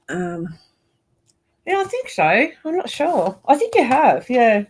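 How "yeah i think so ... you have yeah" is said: talking around a pin in mouth. Real, not acted. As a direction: speak with a pin held in lips. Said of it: "I've got a pin in my mouth now".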